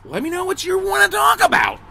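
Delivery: very high, silly falsetto; mock pleading. Host begging viewers to send topics, mock pleading in falsetto.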